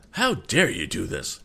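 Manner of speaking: mildly outraged protest; outraged cry. Acted. Struggling captain held by three men, indignant cry. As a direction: protest at being manhandled, a touch indignant. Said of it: "he cried".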